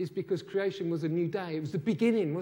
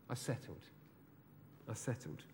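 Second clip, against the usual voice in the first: softer, lower, as a private admission; hushed confession; 6 semitones lower, 14 dB quieter than usual. Pretend. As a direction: let it drop to a near-hush.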